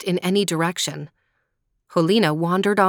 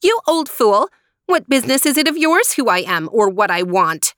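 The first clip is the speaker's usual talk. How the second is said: high, loud, insolent outburst easing slightly; indignant, haughty scolding; rude retort; 4 semitones higher, 5 dB louder than usual. A narrator's reading. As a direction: snap back with open disrespect. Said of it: "Helena looked at him rudely".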